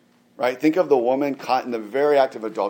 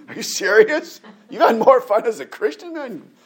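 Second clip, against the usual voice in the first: much higher, laughing disbelief; incredulous; 10 semitones higher, 5 dB louder than usual. Retold or imitated. Preacher voices his younger self, mocking in disbelief. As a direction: scoff like the young skeptic you were.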